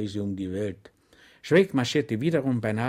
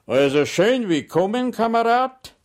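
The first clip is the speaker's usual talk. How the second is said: much higher, louder sugary welcome; sergeant's mock welcome; 12 semitones higher, 9 dB louder than usual. Sergeant welcoming Schweig with exaggerated friendliness, higher and louder. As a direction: greet him with false warmth. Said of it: "sagte der Gendarmerie-Wachtmeister".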